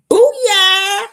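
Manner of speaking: a high, loud cheer of victory; triumphant shout. Real, not acted. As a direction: shout it like you just won. Celebrating the appeal being filed.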